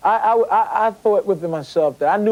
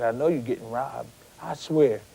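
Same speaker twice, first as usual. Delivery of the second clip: hushed confidential aside, softer and lower; retold whisper; 5 semitones lower, 7 dB quieter than usual. Ali confiding in the boxer that he's getting robbed, retold whisper. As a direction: pass it on quietly, as if into an ear. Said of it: "He whispered in my ears".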